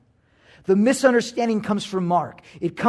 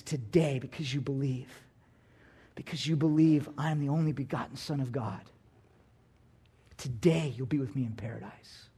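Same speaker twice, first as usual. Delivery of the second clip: much softer, fading to a whisper; hushed whisper; 7 semitones lower, 11 dB quieter than usual. Acted. Jesus promising the dying thief paradise, hushed to a whisper. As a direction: hush it like a tender secret.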